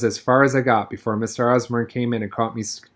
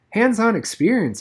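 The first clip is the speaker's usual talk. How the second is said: higher, startled repeat; a boy echoing the teacher, higher and startled; 9 semitones higher than usual. A narrator's reading. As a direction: echo it back, caught off guard. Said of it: "I repeated, startled".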